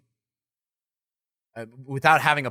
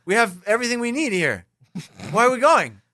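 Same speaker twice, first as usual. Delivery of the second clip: put-on much higher, louder drunk whine; 8 semitones higher, 3 dB louder than usual. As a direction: protest like a wasted passenger. Acted drunk road trip.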